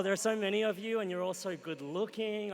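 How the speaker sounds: usual speaking voice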